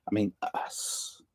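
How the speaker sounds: soft whispered aside. Real, not acted. Quiet aside after the you's.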